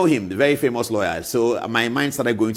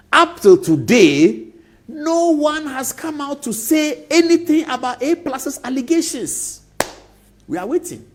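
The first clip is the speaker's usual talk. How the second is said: high, slow, loud up till today; pitched-up indignant complaint; indignant emphasis; 14 semitones higher, 6 dB louder, slower than usual. Real, not acted. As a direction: hammer home that nobody answered. Rising indignation about silence.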